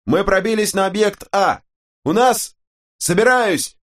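very high, strained shout through static; distant radio voice. A narrator's reading. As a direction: yell over a crackling radio. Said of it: "далёкий голос Рамоса". (далёкий голос по рации сквозь помехи)

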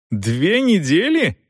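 slow, high, questioning disbelief; incredulous echo. A narrator's reading. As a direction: echo it slowly, as if unbelievable. Son echoes father in disbelief.

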